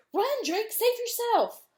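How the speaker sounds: high, loud desperate shout; urgent shout. Acted. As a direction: yell at him to flee. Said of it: "Breen shouted".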